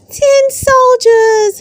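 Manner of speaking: put-on high, slow, thrilled child's gasp; breathless wonder at a present; gasping with delight. A narrator's reading. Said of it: "he gasps".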